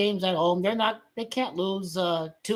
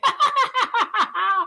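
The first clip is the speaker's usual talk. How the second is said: high loud laughing fit; loud cackle; 14 semitones higher, 5 dB louder than usual. Real, not acted. Streamer laughing at the Cowboys, loud cackle. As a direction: burst out cackling.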